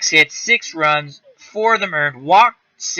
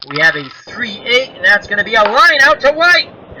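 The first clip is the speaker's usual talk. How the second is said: louder, higher excited broadcaster call; play-by-play call; 7 semitones higher, 6 dB louder than usual. Acted. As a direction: shout the line out like radio. Calls the line out.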